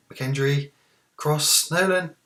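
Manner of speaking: loud, high, climbing to the last name; urgent football commentary; excited match call. Real, not acted. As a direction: call a building chance, pitch rising. Live match call, chance building.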